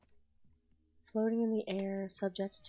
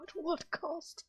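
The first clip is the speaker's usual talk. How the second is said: slow, much higher theatrical cry; dramatic; 10 semitones higher, slower than usual. Pretend. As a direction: overblown melodramatic question. Dramatic repeat.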